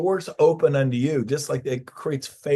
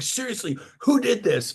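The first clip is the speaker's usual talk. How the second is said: much higher, the owner can't believe it; incredulous demand; 10 semitones higher than usual. Retold or imitated. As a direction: ask it as a stunned boss. Owner demanding who cleaned.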